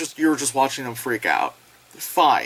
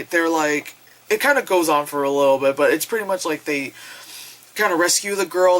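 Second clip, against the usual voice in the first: ordinary delivery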